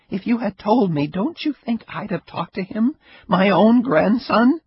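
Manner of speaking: put-on old Ida, reproachful. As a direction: pour out the grievance.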